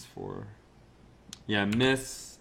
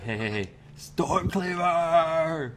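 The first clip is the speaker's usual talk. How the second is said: slow, drawn-out, higher mocking call; taunting berserker; 7 semitones higher, slower than usual. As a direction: goad the enemy with his own name. Raging warrior taunts enemy.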